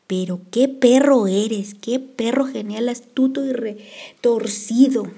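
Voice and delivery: loud, slow, delighted teasing; gleeful praise. A narrator's reading. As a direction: relish every word of praise.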